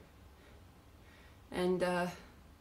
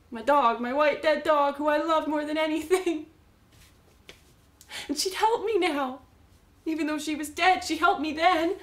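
higher, impassioned recollection; worked-up, loving insistence; fervent devotion; 7 semitones higher, 5 dB louder than usual. Acted. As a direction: insist on it with swelling feeling. Recalling her beloved dead dog.

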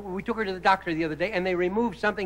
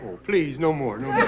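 mock modest actor; 7 semitones lower than usual. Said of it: "they can't".

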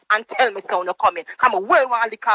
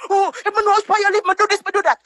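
high, sneering mimicry; mocking quote; 7 semitones higher, faster than usual. Vlogger parrots the woman's hollow threats, high and snide. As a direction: parrot her empty boasts high and snide.

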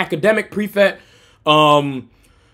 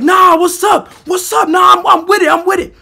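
high, raised-voice squaring up; confrontational challenge; 7 semitones higher, 4 dB louder than usual. Retold or imitated. Teller facing four guys in a half circle, confrontational challenge. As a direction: dare them to step forward.

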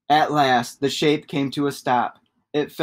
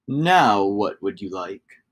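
lower, slow magical shapeshifter; deep shapeshifter; 6 semitones lower, slower than usual. Acted. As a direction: ask slowly like a patient old wizard.

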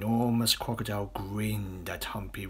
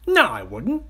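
high, loud little-croc voice; objecting smaller crocodile; 10 semitones higher, 9 dB louder than usual. Acted. Smaller crocodile refusing to eat children, high and indignant.

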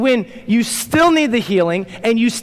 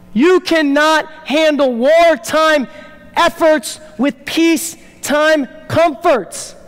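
raised, urgent preaching voice, slowing to hammer the key lines; full-force preaching; 5 semitones higher than usual. Acted. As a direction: preach it like a call to battle.

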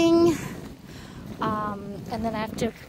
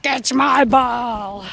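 growly dog voice; 3 semitones lower, 5 dB louder, slower than usual. Retold or imitated. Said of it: "makes a growly sound when he gets to them".

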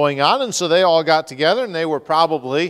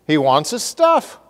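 incredulous retort; 9 semitones higher than usual. Pretend. Preacher voicing his own indignant reply to Dan. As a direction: scoff at the question with raised voice. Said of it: "I'm thinking to myself".

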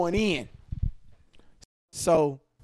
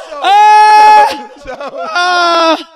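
laughing shout; 13 semitones higher, 18 dB louder, much slower than usual. Real, not acted.

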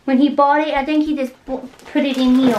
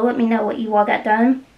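ordinary delivery

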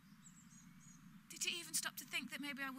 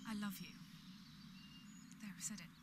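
first i love you; 2 semitones lower, 7 dB quieter, faster than usual. Acted. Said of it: "Say it".

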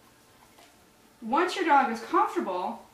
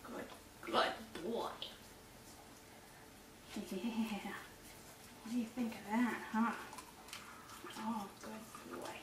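much softer cooing to the dog; near-whispered coaxing to a pet; soft soothing praise; 2 semitones lower, 15 dB quieter than usual. Real, not acted. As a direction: keep the dog calm with hushed praise. Praising dog during second try.

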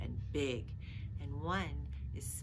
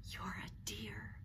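soft unvoiced whisper; tender whisper of thanks; 7 semitones lower, 6 dB quieter, slower than usual. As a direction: whisper it close and grateful. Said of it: "whispered Girl".

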